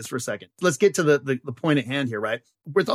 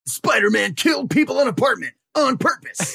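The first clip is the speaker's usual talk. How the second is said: higher, shouted tabloid headline; mock headline shout; 8 semitones higher than usual. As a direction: bark it like a newsboy hawking the paper.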